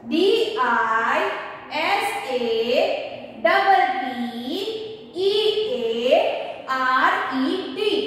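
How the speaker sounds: slow, drawn-out letters; slow spelling. Shown as a demonstration. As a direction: spell it slowly, letter by letter, for a class. Teacher dictating a long spelling. (धीरे-धीरे खींचकर हर अक्षर बोलते हुए)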